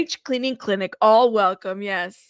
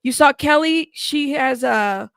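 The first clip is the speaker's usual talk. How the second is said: ordinary talk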